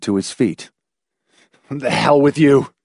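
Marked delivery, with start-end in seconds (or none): 1.7-2.7 raised, angry dismissal; snapped. A narrator's reading. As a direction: snap it at your wife. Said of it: "he snapped".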